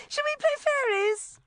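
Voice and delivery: very high, loud sing-song invitation; high trill. A narrator's reading. Rebecca changing tack with the child, trilling. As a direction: trill sweetly at a small child. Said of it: "Rebecca trilled".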